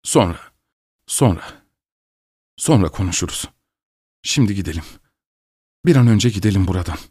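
soft, urgent hushing; hurried. A narrator's reading. Anxious father hurries his daughter out to the carriage. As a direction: rush her away quietly. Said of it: "dedi Pavel Martinoviç telaşla".

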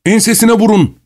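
high call to hit his back; urgent shout. A narrator's reading. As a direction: someone shouting at the table. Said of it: "diye bağırdı biri".

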